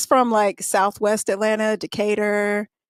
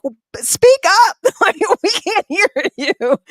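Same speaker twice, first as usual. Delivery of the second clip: much higher, louder mock demand to speak up; imitating classmates; 9 semitones higher, 7 dB louder than usual. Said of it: "this is how she talked to everybody".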